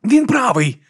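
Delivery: high-pitched urgent agreement; urgent insistence. A narrator's reading. Brother Diaz restraining Viga, urgent insistence. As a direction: insist so that she stops.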